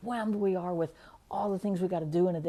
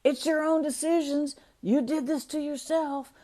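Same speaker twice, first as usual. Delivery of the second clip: mock scolding; 6 semitones higher, 5 dB louder than usual. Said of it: "He didn't say".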